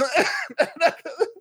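a sudden high giggle, real and unforced; high burst of laughter. Real, not acted. Laughing at own story.

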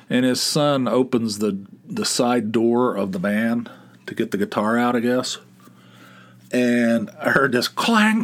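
normal talking voice

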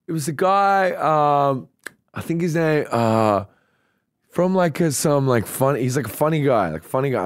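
acted vague, searching answer; imitated hesitant recall. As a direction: grope for the name, pausing often. Stranger struggles to recall the name.

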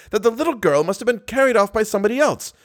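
stage manager berating the managers, roaring. Acted. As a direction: rant like a man whose theatre is falling apart. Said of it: "roared the stage manager".

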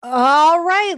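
sudden high flustered burst; sputtered all right. A narrator's reading. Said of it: "Linda sputtered".

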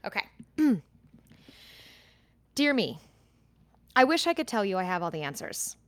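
ordinary talk

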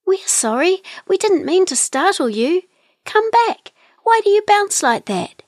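very high, small bird voices; pleading little kiwis. A narrator's reading. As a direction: call after it, high and apologetic. Kiwis call after the fleeing kangaroo.

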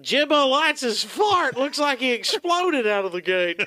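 slow, high race caller; horse race announcer. Pretend. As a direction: call the race like a track announcer. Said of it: "Why is that your announcer voice?".

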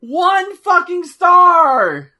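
high, slow outraged yell; furious shout. Real, not acted. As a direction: scream the rating in disgust. Furious at the match.

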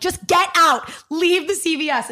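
high, loud yell, slowing on the last words; retold yell. To the paparazzo in the drugstore, a high loud yell. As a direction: shout at him to get out. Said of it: "I'm yelling at this guy".

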